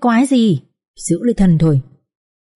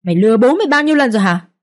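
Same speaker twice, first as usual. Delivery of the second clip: shouting. A narrator's reading. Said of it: "rồi hét lớn".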